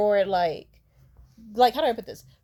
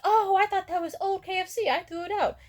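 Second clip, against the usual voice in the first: high-pitched, louder mock realization; imitated innocent mom; 11 semitones higher, 4 dB louder than usual. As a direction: play a mother's casual "oops" up high. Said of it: "then she'd be like".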